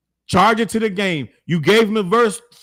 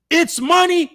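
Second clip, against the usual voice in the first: higher-pitched mock quote; mimicked argument; 9 semitones higher, slower than usual. Commentator quoting opponents' money argument, higher mimicking voice.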